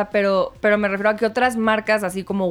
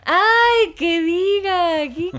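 flustered squeal; 9 semitones higher, 3 dB louder, much slower than usual. Real, not acted. Flustered self-correction, high squeal.

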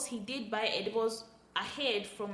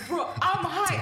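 giggly realization; 5 semitones higher, 5 dB louder than usual. Retold or imitated. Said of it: "I'm like".

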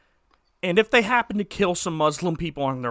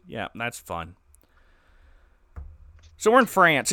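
ordinary talk

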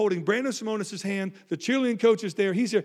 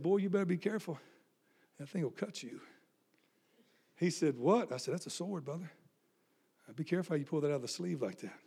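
sarcastic; 9 dB quieter than usual. Retold or imitated. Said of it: "my sarcastic little self".

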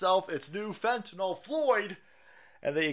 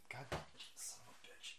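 under his breath, unvoiced curse; whispered curse; 3 semitones lower, 25 dB quieter, faster than usual. Real, not acted. Cat fur in drink, muttered.

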